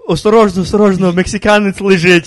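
high, loud mock Russian mother; Russian mom warning. Friend imitating a Russian mother warning her family. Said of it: "their mom's like".

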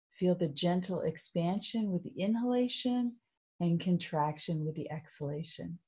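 calm, even, unhurried meditation voice; guided meditation. Real, not acted. Coach guiding listeners through loving kindness, soothing meditation voice. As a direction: gently lead them inward, leaving long pauses.

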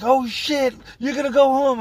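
high, far-off excited yelp; thrilled cellmate. Retold or imitated. Cellmate slapping his arm, shouting with joy.

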